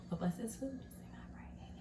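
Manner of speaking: whispered prayer over food. Real, not acted. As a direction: whisper the blessing softly. Whispered blessing before eating.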